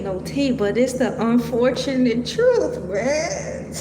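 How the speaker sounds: ordinary talk, nothing marked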